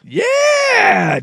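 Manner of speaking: a high, loud whoop of glee; delighted shout. Real, not acted. Cohost cheering the silly headline with a high yelp. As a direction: yelp "Yeah!" with joy.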